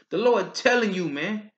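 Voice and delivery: ordinary talk, nothing marked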